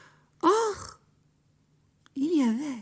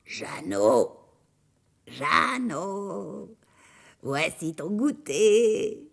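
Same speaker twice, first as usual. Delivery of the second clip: big rasping ogress voice; 2 semitones lower, much slower than usual. Acted. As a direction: call him sweetly with a broken ogress voice. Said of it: "d'une grosse voix tout éraillée". (grosse voix éraillée d'ogresse)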